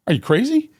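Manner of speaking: you can't be serious, high and incredulous; retold outburst of disbelief, high. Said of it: "I said".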